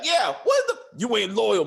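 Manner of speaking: loud, high-pitched imitation of an accusing son; mock accusing son. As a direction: burst out like an aggrieved son, hammed up. Acts out jealous son's outburst.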